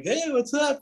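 high-pitched, casual mock hello; breezy greeting. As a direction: imitate a carefree latecomer's greeting. Acts out latecomer's casual greeting.

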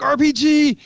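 high-pitched call-out of a threat; retold alarm call. Gunner spotting a weapon, retold as a high alarm call. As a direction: sound the alarm, voice jumping up.

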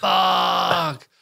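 long, drawn-out high groan of a swear; retold dismayed curse. Host recalling a positive covid test, dismayed curse. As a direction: draw the curse out in mock agony.

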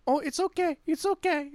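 put-on high, soft old-lady voice; a frail old lady waving off the fuss; old Polish woman. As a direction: shrug it off gently like an old woman. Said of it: "like, old Polish woman".

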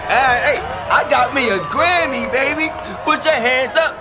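rowdy party animal. Acted. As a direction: hype man shouting to the crowd. DJ hyping up the club crowd.

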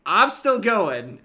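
loud, slow mock-heroic declaration; mock Luke. Cohost mocking Luke walking into the trap, loud and slow. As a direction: belt it out like a stubborn young hero. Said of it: "and then Luke being like".

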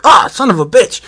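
high, loud mock yelp of pain; pained cursing. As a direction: curse as if you just cracked a tooth. Orc hurts teeth biting acorn.

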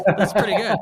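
loud laughing reply. Real, not acted. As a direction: laugh it out, amused. Host laughs after pirate demo.

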